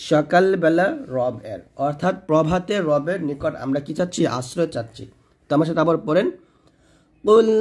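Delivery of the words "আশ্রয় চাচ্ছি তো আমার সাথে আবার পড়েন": ordinary talk, nothing marked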